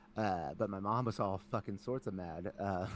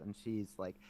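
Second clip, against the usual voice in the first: ordinary talk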